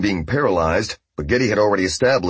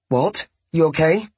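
higher, worried female voice; concerned woman's voice; 9 semitones higher than usual. A narrator's reading. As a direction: ask in Bobby's higher voice.

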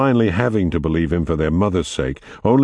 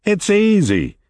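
shouting; slower than usual. Acted. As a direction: call it up from under the bridge. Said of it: "he shouted from under the bridge".

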